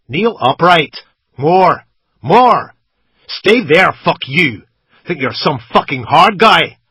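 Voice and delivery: a gunman screaming down at his prisoner; furious shout. A narrator's reading. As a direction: bellow the orders at full force. Captor yelling at captive.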